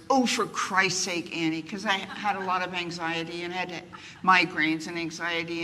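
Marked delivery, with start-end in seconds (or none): 0.1-1.6 a hint of an exasperated mother, slow; drawn-out mock scolding from mom; mother's exasperated cry. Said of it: "my mother's battle cry was".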